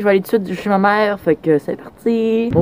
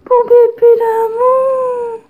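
very high, slow cooing pet voice; slower than usual. Real, not acted. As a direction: coo to the puppy. Baby talk to her dog.

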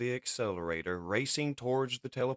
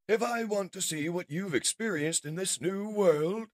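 high, louder villain musing; menacing mad scientist; 12 semitones higher, 5 dB louder than usual. Acted. Mad scientist looms over Cliffjumper with a device, menacing. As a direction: build the threat with rising pitch.

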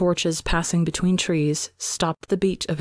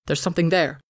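lightly voiced gruff soldier, barely changed; a hint of a rough soldier spotting something; faster than usual. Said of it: "A gruff voice".

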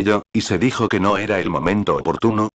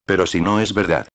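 usual speaking voice

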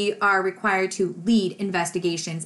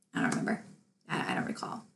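whispered, evasive little mumble; mock whisper; 4 semitones lower, 10 dB quieter, much faster than usual. Mocking the officer dodging questions, whispered. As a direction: shrink away and breathe the words.

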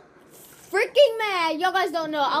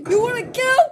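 taunting shout; 5 semitones higher, 5 dB louder than usual. Real, not acted. Taunting enemy mid-fight.

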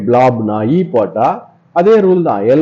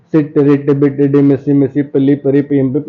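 quick, evenly pitched word-list drill; rapid drill. Shown as a demonstration. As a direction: rattle the short words off in an even run. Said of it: "இப்படி வேகமா சொல்றது practice பண்ணுங்க".

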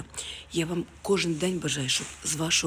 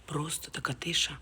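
whisper. Real, not acted. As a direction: whisper so nobody wakes. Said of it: "А чого я тихо говорю?".

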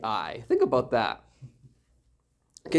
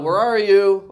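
louder, higher parental call; 6 semitones higher, 7 dB louder, slower than usual. Acted. Father to his kids who ran away, calling out. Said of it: "I call out".

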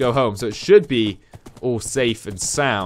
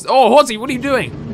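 surprised call to horse; 10 semitones higher, 4 dB louder than usual. Real, not acted. Horse wanders into the way.

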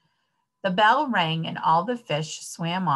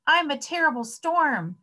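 high, falling mild yell; excited yell; 8 semitones higher than usual. A narrator's reading. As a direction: yell it like an overexcited child. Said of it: "Sammy yelled".

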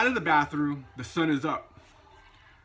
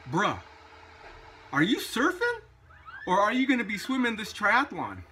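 put-on laid-back California surfer accent, pitched higher; 6 semitones higher than usual. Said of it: "in a deep California surfer's accent".

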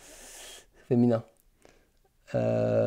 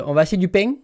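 southern French accent, louder, with "pain" as "peng"; 3 semitones higher, 5 dB louder, much faster than usual. Shown as a demonstration. Streamer showing viewers when the accent comes out, southern French accent. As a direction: let the accent of the South of France come out. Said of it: "mon accent, il ressort si je dis".

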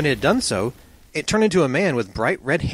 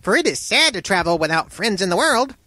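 small, high exclaiming voice; eager fire man; 10 semitones higher, 3 dB louder than usual. A narrator's reading. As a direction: cry out gladly as a little flame turned man. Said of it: "he cried".

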